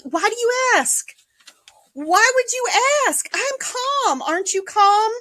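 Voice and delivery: sing-song high "I'm calm" that isn't; mock innocent denial. Insisting she is calm, clearly rattled.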